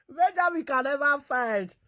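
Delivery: words squeezed through laughter; laughing through the line. Real, not acted. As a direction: say it while laughing hard. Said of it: "laugh cry".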